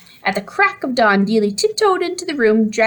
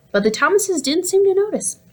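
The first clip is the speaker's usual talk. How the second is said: plain reading voice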